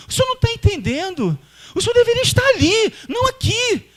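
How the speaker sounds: very high, frantic objection; mock-panicked high whine at Jesus; panicky protest. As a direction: squeal it in alarm, backing out.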